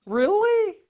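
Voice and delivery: high, loud mocking disbelief; incredulous really. Real, not acted. Preacher scoffs at the spies' fear, loud and high. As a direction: fling back a scornful "Really?".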